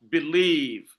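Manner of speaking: believe with a light be- before a strong -lieve; stress on second syllable. Shown as a demonstration. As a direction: demonstrate be-LIEVE for learners. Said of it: "unstressed syllable, followed by the stressed syllable".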